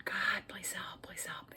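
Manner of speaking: urgent whispered prayer; whispered plea. Acted. Gordy hides as the door opens, whispered plea. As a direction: pray under the breath, fading away. Gordy prays while hiding.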